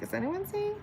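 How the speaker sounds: wondering if anyone's there; lonely high question. Retold or imitated. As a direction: call into the empty room in a small high voice. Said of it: "I'm like".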